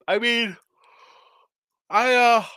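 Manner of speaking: talking through a yawn. Real, not acted. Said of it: "excuse me for the yawn".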